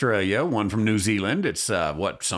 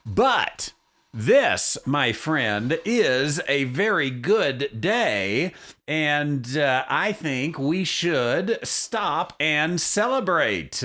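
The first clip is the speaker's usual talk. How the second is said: slow, drawn-out celebratory build-up; 4 semitones higher, much slower than usual. Acted. Host hyping up the day for his listeners, slow and grand. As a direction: stretch it out grandly.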